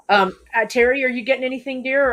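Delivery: ordinary talk, nothing marked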